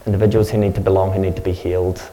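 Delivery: prayer. Real, not acted. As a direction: pray over them with steady conviction. Pastor prays over congregation.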